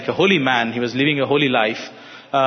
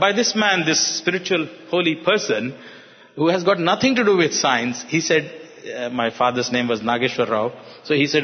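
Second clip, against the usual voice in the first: ordinary delivery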